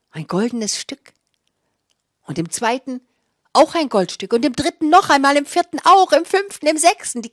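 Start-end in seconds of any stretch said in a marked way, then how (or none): 2.2-7.2 counting higher and louder each time; finding gold coins, building higher and louder; rising excitement. A narrator's reading. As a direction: build the joy with every gold piece.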